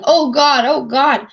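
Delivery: a leader cracking under pressure; stressed. Retold or imitated. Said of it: "he's so stressed".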